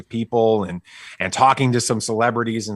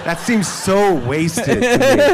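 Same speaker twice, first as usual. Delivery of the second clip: mock outrage; 11 semitones higher, 6 dB louder, slower than usual. Joking about the roasted porg.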